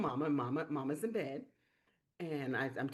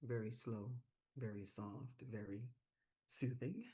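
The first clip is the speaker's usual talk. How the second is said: soothing DJ voice; 6 semitones lower, 12 dB quieter than usual. Shown as a demonstration. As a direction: melt into a soft late-night radio voice. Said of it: "I used my D J voice".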